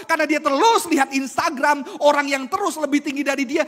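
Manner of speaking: loud, high, driving sermon voice; fiery pulpit delivery; forceful preaching. Acted. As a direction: preach it hard to the congregation.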